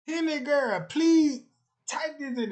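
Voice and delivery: loud excited call. Real, not acted. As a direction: hail her across the room. Calling out to chatter.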